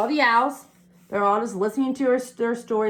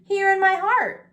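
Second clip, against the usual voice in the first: eager; 8 semitones higher, 4 dB louder than usual. A narrator's reading.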